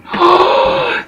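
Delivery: sharp high gasp of fake shock; high pitched theatrical gasp; mock gasp. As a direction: gasp as if startled by the food. Mock surprise at food.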